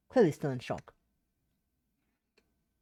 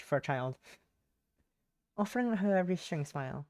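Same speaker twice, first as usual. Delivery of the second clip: ordinary talk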